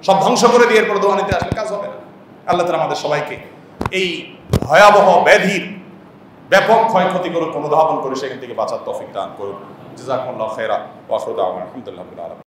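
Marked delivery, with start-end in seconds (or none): 2.5-9.6 lower, earnest prayer voice; sermon prayer. Acted. Final prayer. 10.0-12.4 low, fast, murmured formula; closing Arabic blessing. Acted.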